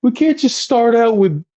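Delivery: high-pitched mock pleading; urgent persuading. Friend justifying the girls to Rich, high urgent voice.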